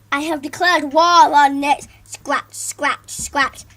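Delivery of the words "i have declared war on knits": loud battle cry of a teacher; stern declaration. Acted. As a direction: declare war firmly.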